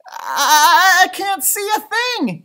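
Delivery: high, loud panicked yell. A narrator's reading. Pip yells as the birds fly blind at night. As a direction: cry out blind in the dark. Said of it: "yelled Pip".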